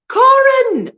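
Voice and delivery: high, loud delighted call of the name; excited greeting. Real, not acted. Greeting a newly arrived viewer, high excited call.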